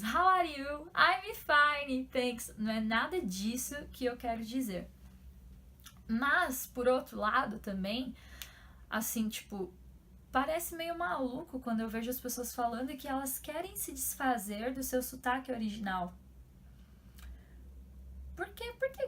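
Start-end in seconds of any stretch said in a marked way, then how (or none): 0.0-2.5 chirpy, exaggerated scripted-lesson English; mock textbook English. Mocking those cliché English-lesson videos, hammed-up textbook voice. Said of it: "tipo aqueles vídeos". 18.4-19.1 incredulous why. Real, not acted. Wondering why people drop their accent, incredulous. Questions wanting to lose accent.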